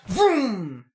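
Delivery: imitated revving car; drawn-out engine vroom; engine noise. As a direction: make a car peel-out noise.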